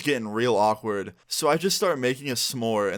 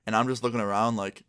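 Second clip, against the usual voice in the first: ordinary talk, nothing marked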